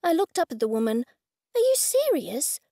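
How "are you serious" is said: surprised. Acted. Narrator in disbelief.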